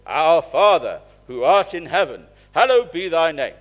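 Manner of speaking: full-volume prayer. Retold or imitated. Dad leading the church service, praying the Lord's Prayer booming loud. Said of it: "it would be at full volume".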